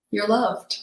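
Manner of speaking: soft loving echo. Retold or imitated. Inner voice repeating comfort to Julie, softly fading. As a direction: let "You're loved" fade to a tender hush. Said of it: "in a very loving way".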